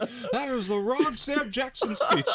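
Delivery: high, laughing quote of the line; real laughter breaking into the quote; laughing through words. Real, not acted. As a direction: crack up while quoting it. Quoting scene while laughing hard.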